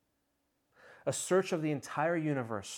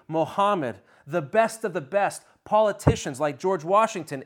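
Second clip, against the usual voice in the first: building pulpit proclamation, louder toward the end; full-force preaching; 4 semitones higher, 5 dB louder than usual. Acted. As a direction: ring it out like a church bell calling everyone. Preacher listing all who failed.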